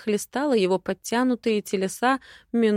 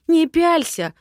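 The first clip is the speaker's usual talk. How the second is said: sharp bark, much higher and louder; 9 semitones higher, 7 dB louder than usual. Acted. Lady barks at Rowen to stop staring, sharp and loud. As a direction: snap at him to stop staring. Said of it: "гаркнула на него".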